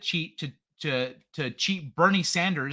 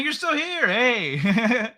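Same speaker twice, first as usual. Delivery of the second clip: surprised; 7 semitones higher, 3 dB louder, much slower than usual. Real, not acted. Surprised greeting to a chatter.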